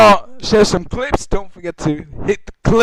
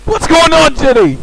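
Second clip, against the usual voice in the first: high, loud cry to Jenny; alarmed calling out; 9 semitones higher, 4 dB louder than usual. Acted. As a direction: call out in dramatic alarm. Calling out to cartoon character.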